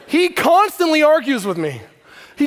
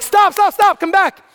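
put-on higher, louder calling after a kid; 9 semitones higher, 6 dB louder than usual. Dad calling his son back to put on a helmet. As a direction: call him back across the cul-de-sac.

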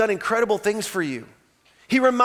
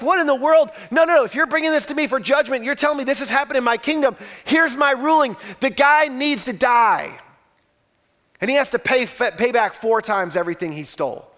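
David freaking out; 3 semitones higher than usual. Retold or imitated. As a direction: play David losing it over the injustice. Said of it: "He's like".

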